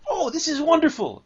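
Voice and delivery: put-on high, loud childlike delight; delighted kid. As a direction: squeal it like a thrilled little kid. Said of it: "and it's gonna".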